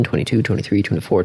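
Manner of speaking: ordinary delivery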